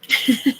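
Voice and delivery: loud high laugh; short loud giggle. Real, not acted. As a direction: crack up laughing. Laughs at the stream.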